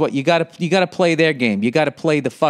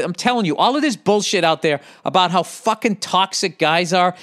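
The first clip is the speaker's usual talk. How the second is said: ordinary talk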